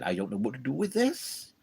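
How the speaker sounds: lightly imitated Welsh lilt; bad Welsh accent. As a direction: do a rough impression of a Welshman. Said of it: "Sorry about the bad impression".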